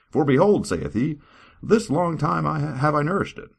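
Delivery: high, grand lord's boast; proud lord. Acted. God boasting to the servant, high and grand. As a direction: boast like a pompous master. God's quoted speech, raised.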